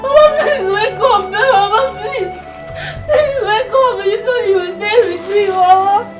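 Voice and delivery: high sobbing wail, half sung; wailing sobs. Acted. Girl wailing over her dead grandma, sobbing. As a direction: cry out for help through tears.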